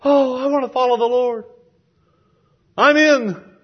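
high, loud burst of euphoria; over-the-top joyful declaration; euphoric. Acted. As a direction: gush it like a swept-up new convert. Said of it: "they get euphoric, and they say".

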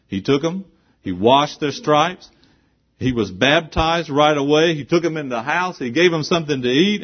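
high, loud pulpit proclamation; fiery sermon climax; full-force preaching. Acted. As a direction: hammer each line home. Preaches jailer's joy at full force.

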